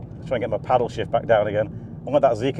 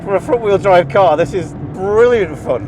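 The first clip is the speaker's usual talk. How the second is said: excited; 6 semitones higher, 6 dB louder than usual. Real, not acted. Thrilled on track.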